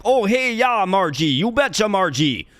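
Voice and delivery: hammed-up Minnesota accent, high and singsong; mock a Fargo-style Minnesota accent. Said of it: "I don't sound like".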